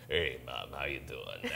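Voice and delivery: very low, deep newborn voice. Retold or imitated. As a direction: drop it low like a baby with a man's voice. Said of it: "It was like".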